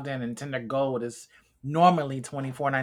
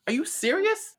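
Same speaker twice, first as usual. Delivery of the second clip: incredulous. Real, not acted. Incredulous at heckling.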